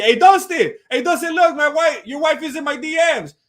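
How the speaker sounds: loud, high heckler yelling for attention; calling out. Retold or imitated. Pushy fan hollering at a fighter passing by, calling out.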